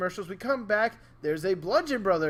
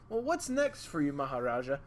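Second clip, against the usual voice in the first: put-on little boy; 4 semitones lower, 6 dB quieter than usual. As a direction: imitate a curious child, dropping at the end. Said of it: "there's this little boy who comes up to him".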